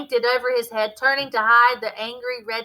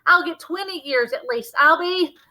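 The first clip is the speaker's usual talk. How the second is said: frightened; 8 semitones higher, 5 dB louder than usual. Acted. Scared boy fears prison sentence.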